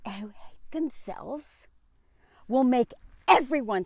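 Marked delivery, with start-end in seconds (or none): 0.1-1.7 slow, hushed whisper; slow whispered aside. Real, not acted. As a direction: let it sink to a whisper. Voice drops to a whisper.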